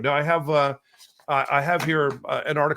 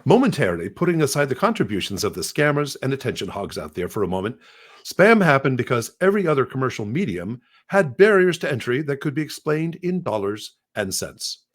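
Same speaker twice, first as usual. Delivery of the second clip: bad British accent. Shown as a demonstration. Presenter recording a sample for a voice clone, bad British accent. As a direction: fake British reading voice, deliberately attempted. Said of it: "I'll try to do a bad British accent".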